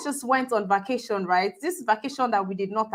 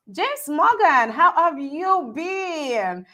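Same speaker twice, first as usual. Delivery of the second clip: higher, drawn-out hello; delighted greeting; 5 semitones higher, much slower than usual. Real, not acted. Host greeting a returning viewer, delighted and drawn out. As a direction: stretch out a high, happy greeting.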